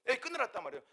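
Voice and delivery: ordinary talk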